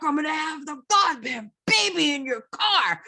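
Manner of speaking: high, loud angry yell at the trucker; a pregnant woman yelling at a trucker to move. Retold or imitated. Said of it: "and she goes".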